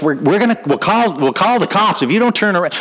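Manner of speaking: retold yell. Warning a wrong-way driver with the windows down, shouted. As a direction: call it out as a warning from the car. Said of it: "And yelling, like".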